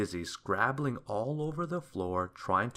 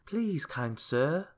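put-on slow, high, pleading old woman; old washerwoman; 6 semitones higher, slower than usual. A narrator's reading. Washerwoman stopping Mister Mean, slow and pleading. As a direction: ask as a needy old washerwoman. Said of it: "she asked".

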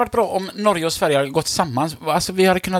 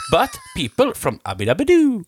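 raised pitch with a long drawn "do"; higher, singsong cartoon cheer on the last words; cartoon catchphrase punchline; 7 semitones higher than usual. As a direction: land the punchline like a yabba-dabba-doo. Punchline mimics Flintstones catchphrase.

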